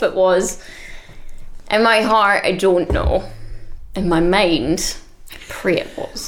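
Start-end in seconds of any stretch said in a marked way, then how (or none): 5.3-6.3 hushed whispered prayer. Real, not acted. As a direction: breathe it like a secret prayer. Mock-solemn hope about the bird.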